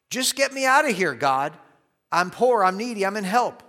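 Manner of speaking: urgent, loud and high, begging; frenzied plea. Retold or imitated. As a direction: cry out in a frenzy to be rescued. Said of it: "It's a frenzied, uh, ask for God to deliver him".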